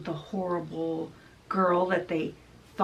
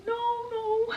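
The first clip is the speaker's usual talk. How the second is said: very high, wailing mock plea; pleading yell; 14 semitones higher, 3 dB louder than usual. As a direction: cry out like scared girls being dragged off. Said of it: "they're yelling".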